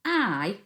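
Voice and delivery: teacher stresses the word I; stressed I. Shown as a demonstration. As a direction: lean on I so they hear the capital word. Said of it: "the first word of this sentence is I".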